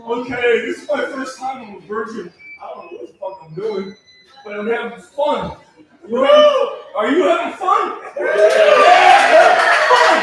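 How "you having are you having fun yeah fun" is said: loud, high crowd-rousing shout; calling out. Real, not acted. Comic asking the audience if they're having fun, calling out. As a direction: holler it up high to rouse the room.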